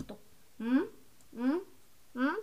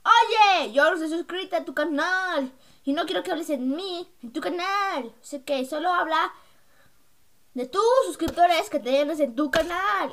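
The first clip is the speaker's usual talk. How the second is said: loud, raised, complaining mimicry; acted-out message; 4 semitones higher, 7 dB louder, slower than usual. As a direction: imitate someone's loud demand, pitched up. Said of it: "me dijo en Facebook".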